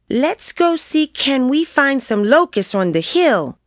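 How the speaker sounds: put-on high-pitched kid's voice; child suggesting. A narrator's reading. One of the bored kids to the group, suggesting eagerly. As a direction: pipe up high like one of the gang. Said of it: "someone suggested".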